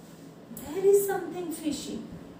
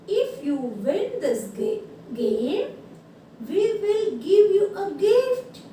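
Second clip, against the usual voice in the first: very slow, emphatic letter reading; drawn-out classroom read-aloud; 3 semitones higher, 5 dB louder, much slower than usual. Real, not acted. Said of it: "now I will read this".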